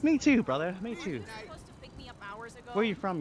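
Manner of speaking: ordinary talk, nothing marked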